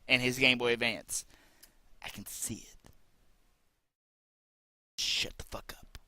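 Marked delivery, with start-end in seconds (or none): 2.0-6.0 whisper. Real, not acted. Whispered aside.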